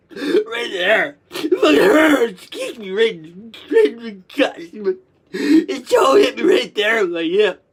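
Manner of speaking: sobbing in pain. Retold or imitated. Child kicked in the stomach, sobbing that it hurts. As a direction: wail through gasping tears.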